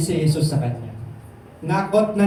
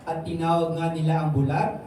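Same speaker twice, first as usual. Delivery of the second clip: usual speaking voice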